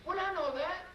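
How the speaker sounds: very high woman's voice, affectionate scolding; wife's fond reply. Retold or imitated. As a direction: pitch way up, tease him fondly. Wife answers husband's confession.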